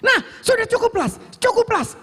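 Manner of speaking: high-pitched urgent pleading; panicked yell. Retold or imitated. Younger sibling yelling at the driver to stop, panicked. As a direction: imitate a frightened sibling begging to stop. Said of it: "Adik saya teriak".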